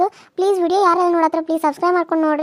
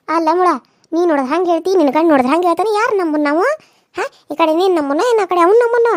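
loud, heated demand; angry outburst; 6 dB louder than usual. Acted. Woman loudly confronts her aunt over whose story is true, angry. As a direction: confront her, voice raised and hot. Quarrel about husband's claims.

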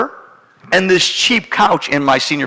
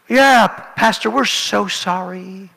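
higher voice, slowing on the apology; apologetic wife; 4 semitones higher, slower than usual. Retold or imitated. Wife apologizing to pastor after the fight, contrite. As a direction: imitate a contrite wife, drawn out.

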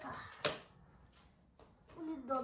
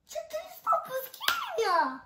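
much higher and louder, triumphant; excited boast; 14 semitones higher, 7 dB louder than usual. Real, not acted. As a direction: shout it out, thrilled with the number. Boy brags about bullet count. (восторженный крик)

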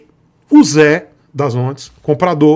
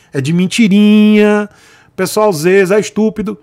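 put-on talking down to a child; 3 semitones higher, 5 dB louder than usual. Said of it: "Pensa no teatrinho da escolinha".

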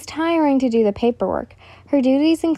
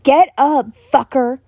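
yelling; 3 semitones higher, 5 dB louder than usual. Acted. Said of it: "Bakugo yelled".

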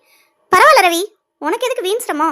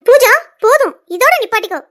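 sharp, higher, louder scolding; angry rebuke; 5 semitones higher, 7 dB louder than usual. Acted. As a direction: cut her off firmly and loudly. Friend scolds Pooja to stop.

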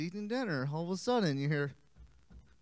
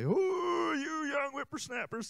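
put-on high, creaky old-man scolding; 9 semitones higher, slower than usual. Shown as a demonstration. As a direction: sound like a grumpy grandpa. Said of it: "what's something more fun that we can say".

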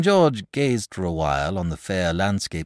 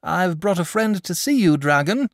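raised, higher call to wake him; rather loud; 9 dB louder than usual. A narrator's reading. Boy calling loudly to the sleeping dragon, loud and higher. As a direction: pitch it up loud so the dragon hears. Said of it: "said the boy rather loud".

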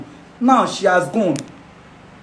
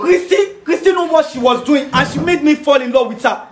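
anguished outburst; 7 semitones higher, 4 dB louder than usual. Acted. Betrayed friend bursts out.

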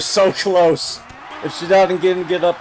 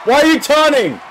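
loud, much higher, baffled yell; exasperated shout; 12 semitones higher, 8 dB louder than usual. Real, not acted. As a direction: demand an answer from the screen. Exasperated at wrestler taunting.

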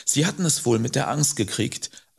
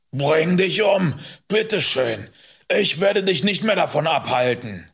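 raised, sarcastic dismissal; scornful calling out; 5 semitones higher, 4 dB louder than usual. Acted. Rider gives up on fleeing boy, calling scornfully.

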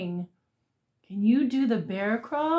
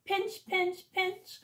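crab pinching; 7 semitones higher, 7 dB quieter than usual. Pretend.

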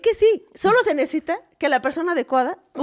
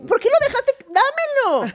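indignación muy aguda, actuada; reclamo indignado; 12 semitones higher than usual. Acted. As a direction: exige exasperada, con la voz disparada arriba. Reclamo actuado por aventar cosas. (reclamo exagerado)

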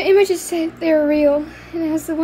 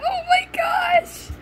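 much higher, slow shrieking squeal of delight; excited squeal; 12 semitones higher, slower than usual. Real, not acted. Girl revealing her mascot to viewers, squealing with delight. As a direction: squeal with joy at the big reveal.

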